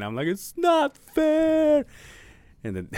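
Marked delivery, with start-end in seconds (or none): 0.3-1.8 high, slow, wailing "It's not fair"; crying wail. Retold or imitated. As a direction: wail it out like sobbing. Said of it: "I remember I was crying".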